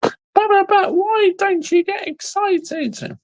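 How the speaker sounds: high childish mimicry; mock whiny fan. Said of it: "mimicking the commenter".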